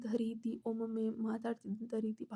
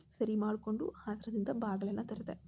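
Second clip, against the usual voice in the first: ordinary delivery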